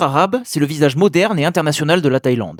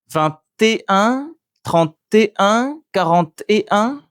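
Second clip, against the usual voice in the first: slow, higher "et un", syllables split apart, lilting upward; stress on ET; 6 semitones higher, slower than usual. Shown as a demonstration. Teacher giving francophones a memory trick, separated stressed "et". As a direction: spell out "et" slowly in each number, pitched up. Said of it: "on utilise le mot et".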